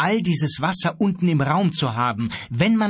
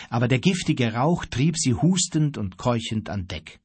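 usual speaking voice

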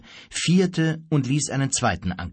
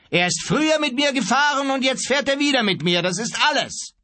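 high, insistent raised voice; calling out; 13 semitones higher than usual. A narrator's reading. Calls across in the shouted quarrel. (laut und nachdrücklich rufen)

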